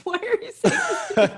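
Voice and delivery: put-on high, laughing little-kid protest; laughing mock whine. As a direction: squeal it through laughter like a startled child. Retold childhood reply, laughing.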